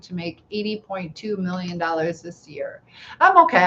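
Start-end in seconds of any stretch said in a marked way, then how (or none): 3.2-3.6 exclaimed. Real, not acted. Reacting to a money comment.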